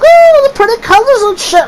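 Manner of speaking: very high, spaced-out mock voice; stoned wonder. Stoned friend tugging on your shirt, high dreamy mock wonder. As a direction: imitate a stoned friend cooing in high falsetto.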